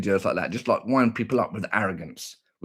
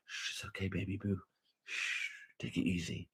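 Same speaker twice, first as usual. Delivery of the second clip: soothing hush; 3 semitones lower, 16 dB quieter than usual. Acted. Streamer soothing a baby boo, hushed calming whisper. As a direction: coo it very softly. Soothing someone while brushing hair.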